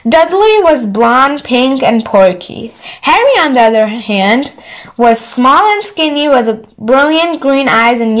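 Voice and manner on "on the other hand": plain reading voice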